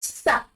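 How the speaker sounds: Sa, a clear S, not K; French ça. Shown as a demonstration. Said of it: "we have sa".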